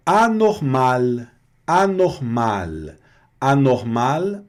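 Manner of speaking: anormal said slowly, oral A, clear final L; slow careful demo. Shown as a demonstration. Teacher drilling anormal for students. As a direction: drag out anormal, oral A and a full L. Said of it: "olha o L aqui bem".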